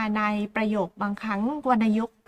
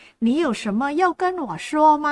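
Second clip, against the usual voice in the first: 你有什么要跟我说吗 said slowly, each syllable clear, stress on 要; slow Mandarin model; 3 semitones higher, slower than usual. Shown as a demonstration. Teacher models Mandarin for Thai learners, slowly with 要 stressed. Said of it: "ตรงกับภาษาจีนกลางนะคะว่า".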